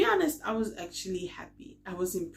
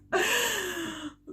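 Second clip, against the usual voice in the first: high laughing burst; burst of laughter; 14 semitones higher, 6 dB louder than usual. Real, not acted. Laughing at herself after recalling shouting. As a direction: laugh at yourself.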